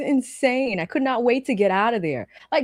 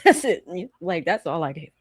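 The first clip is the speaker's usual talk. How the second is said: ordinary delivery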